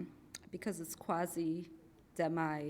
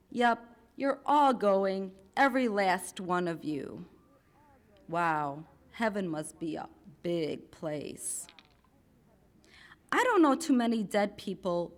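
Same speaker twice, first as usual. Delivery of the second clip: poetry reading; 2 semitones higher, 6 dB louder than usual. Acted. Reads own poem aloud.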